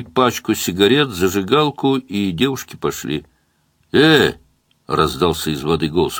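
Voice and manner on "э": loud high call; shout. A narrator's reading. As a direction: yell to get attention. Man calling from the water.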